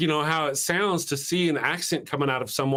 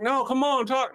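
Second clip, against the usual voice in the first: eager pleading; 9 semitones higher than usual. Retold or imitated. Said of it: "I'm like".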